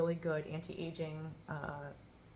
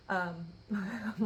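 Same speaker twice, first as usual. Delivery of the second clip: soft whisper. Real, not acted. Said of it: "like, talking softly".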